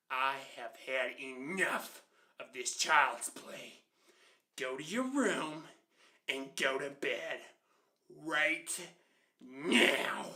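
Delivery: slow, loud, word-by-word scolding; angry mom. Retold or imitated. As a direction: lay down the law, pausing before each order. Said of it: "to, like, being angry".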